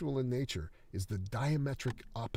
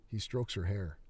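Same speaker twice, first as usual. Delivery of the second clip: ordinary delivery, nothing marked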